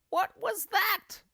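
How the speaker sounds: high, slow startled hag cry; startled old woman. Acted. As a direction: jump at the strange touch. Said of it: "cried Missus Twit".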